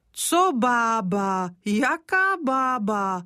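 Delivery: put-on slow, sharp, offended old woman; sharp retort. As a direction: snap back, insulted at being called old. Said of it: "ozvala se ostře Kořínková".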